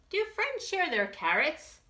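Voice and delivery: asking hopefully; lightly voiced hungry bear; hopeful Big Bob. A narrator's reading.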